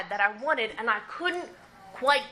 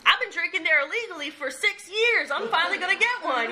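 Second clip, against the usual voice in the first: mock young self; 8 semitones higher than usual. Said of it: "I was like".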